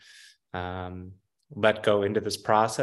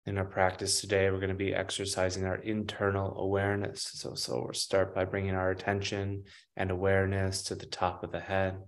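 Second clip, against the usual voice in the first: guided meditation; 3 dB quieter than usual. Acted. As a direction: guide them gently, unhurried, long pauses between phrases. Leads a grounding meditation.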